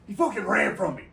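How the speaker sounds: loud, high mock outrage; retold outraged report; indignant outburst. Acting out indignant coworker's complaint.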